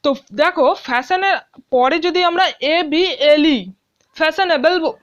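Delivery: normal talking voice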